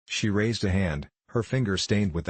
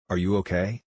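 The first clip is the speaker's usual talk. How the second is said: ordinary delivery, nothing marked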